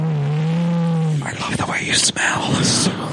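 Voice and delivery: slow breathy creeper whisper; creepy whisper. Pretend. Creeper impression, heavy breathing.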